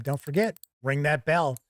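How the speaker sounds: ordinary delivery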